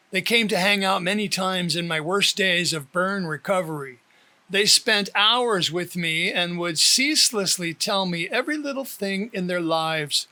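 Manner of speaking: ordinary delivery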